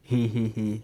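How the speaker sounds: quick soft giggle; little amused laugh; short giggle. Real, not acted. Vlogger chuckling at his own point, soft giggle.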